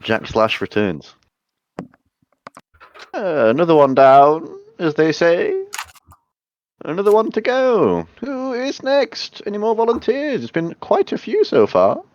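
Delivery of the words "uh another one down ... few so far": villain voice. Acted. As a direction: gloat over the next victim. Villain Jack Slash taunting party.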